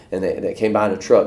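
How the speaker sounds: ordinary talk, nothing marked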